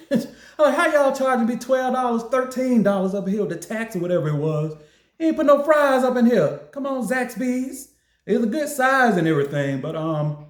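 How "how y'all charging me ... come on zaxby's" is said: high, laughing mock outrage; indignant complaint. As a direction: scold the restaurant half joking. Complaining to Zaxby's about fries.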